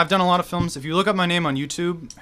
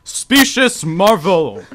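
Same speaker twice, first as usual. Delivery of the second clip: loud, slow heroic boom; grand superhero; 5 semitones higher, 7 dB louder, slower than usual. Acted. Superhero to city folk, booming slow arrival. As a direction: announce yourself like a pompous hero.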